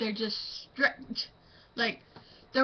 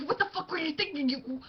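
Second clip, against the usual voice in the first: mock angry outburst; 5 semitones higher, 4 dB quieter than usual. Student yelling at friend, mock.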